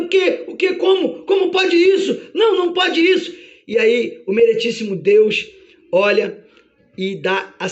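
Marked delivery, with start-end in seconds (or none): 0.0-3.3 high, spluttering disbelief; flabbergasted, acted protest; Satanás, stunned. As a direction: fire out shocked questions. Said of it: "E aí Satanás fica atordoado".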